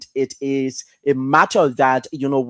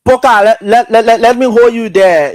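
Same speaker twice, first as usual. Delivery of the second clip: higher and louder, cutting in to stop him; loud interruption; 6 semitones higher, 9 dB louder than usual. Real, not acted. Host breaking in loudly to halt the caller.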